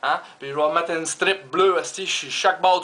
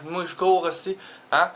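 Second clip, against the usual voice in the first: ordinary talk, nothing marked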